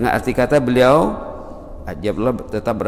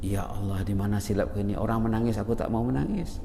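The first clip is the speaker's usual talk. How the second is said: softer, murmured plea to God; hushed pleading prayer; 4 semitones lower, 10 dB quieter than usual. Acted. Preacher voicing a worshipper's prayer to God, hushed and pleading. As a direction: pray softly, ashamed you cannot weep.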